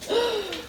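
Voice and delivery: sharp, high intake of breath; amazed gasp. Real, not acted. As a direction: gasp softly in delight. Amazed at the cooked food.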